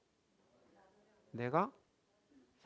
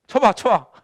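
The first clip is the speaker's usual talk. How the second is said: mock defiant dare; 5 semitones higher, 9 dB louder than usual. Defiantly offering the other cheek.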